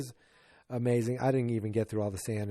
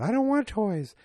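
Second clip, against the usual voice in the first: put-on high, loud kid voice; imitated child pleading, pitched way up; little girl's plea; 10 semitones higher, 5 dB louder than usual. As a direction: insist like a child with one big wish. Voices little girl pleading with Santa.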